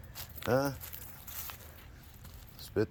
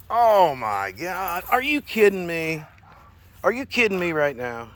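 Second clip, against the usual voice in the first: exasperated disbelief; 6 semitones higher, 8 dB louder, slower than usual. Real, not acted. Dog ate bird, owner exasperated.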